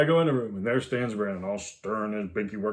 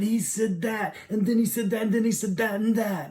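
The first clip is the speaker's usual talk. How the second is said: put-on tattling little girl; 9 semitones higher than usual. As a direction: list the offences like an outraged little girl. Daughter tattling to dad.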